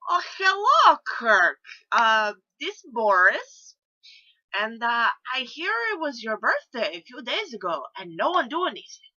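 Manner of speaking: put-on loud, high Russian-accented phone greeting. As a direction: boom it down the phone as an indignant Russian. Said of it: "they find out I do Russian accent".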